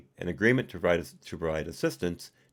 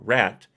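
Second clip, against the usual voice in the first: rat said louder as if in quotes; scare-quoted emphasis; 6 dB louder than usual. Shown as a demonstration. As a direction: lean on rat mockingly. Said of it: "quote unquote".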